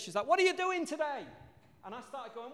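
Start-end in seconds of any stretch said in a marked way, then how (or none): 0.3-1.2 high-pitched mum calling up; imitated shout from downstairs. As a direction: mimic a mum hollering upstairs. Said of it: "she shouted from downstairs".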